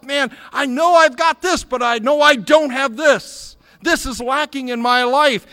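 loud, high self-confession in full preaching force; imagined inner voice. Retold or imitated. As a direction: cry out the admission as a churchgoer. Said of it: "you say to yourself".

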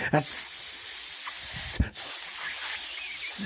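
unvoiced stammering hiss. A narrator's reading. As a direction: hiss the s without any voice. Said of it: "hissed Gollum".

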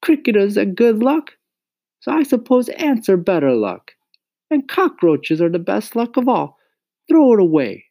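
mother mocking crickets as good luck, dry and high; dry disbelief. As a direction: scoff at the good-luck idea, dry and sarcastic. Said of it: "sounding very dry when she didn't believe".

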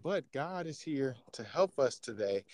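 slow, soft, even preacher voice; preacher's monotone. Acted. Preacher lulling the congregation to zone out, slow monotone. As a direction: settle into a slow, hushed sermon tone. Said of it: "Everything's all monotone".

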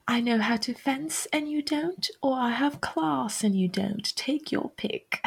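mock posh English accent, pitched high; a lightly hammed-up English accent with haughty disdain; amateur English accent, high and teasing; bad English accent. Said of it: "sorry for my bad English accent".

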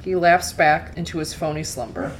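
usual speaking voice

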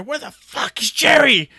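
high, drawn-out imitation of a furious wife; mock angry yelling. Wife phoning to hunt down her husband, mock angry yell. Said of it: "he could hear her yelling".

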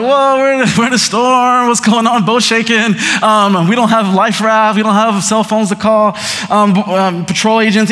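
high, loud panicky rush; mock panic in a boat in a storm; panicked disciples. Disciples panicking in the storm, hammed-up alarm. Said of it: "the disciples are freaking out".

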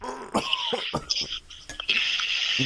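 harsh cough after a bad sip; disgusted cough. Real, not acted. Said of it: "I'm reeling from that".

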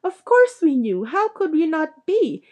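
high, indignant outburst; shrill, shrieking exasperation; Aunt Petunia shrieking. A narrator's reading. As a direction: pitch it high and scornful. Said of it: "shrieked Aunt Petunia suddenly".

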